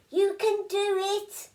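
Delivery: high, slow, excited yell of encouragement; encouraging yell. A narrator's reading. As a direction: cheer him on from below. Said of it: "yelled Tiny from below".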